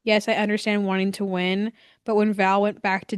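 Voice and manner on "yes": ordinary delivery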